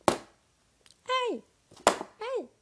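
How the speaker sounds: sudden high-pitched yell; high shout. Acted. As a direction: yelp as the books fly. Scholars brawling.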